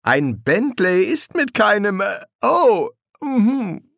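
high, loud start that breaks into hesitation; flustered Aziraphale. Acted. Passenger stumbling over a remark about the Bentley, flustered. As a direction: falter on high little interjections. Angel falters in mid-sentence.

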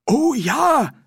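put-on high, loud, eager old man. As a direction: blurt it out, thrilled. Windle eagerly answers the offer.

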